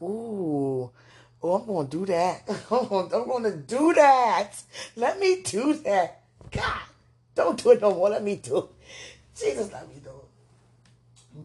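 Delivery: worked-up squeal of wanting in; excited outburst. Retold or imitated. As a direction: burst out, begging for a turn. Acting out her eager reaction to video.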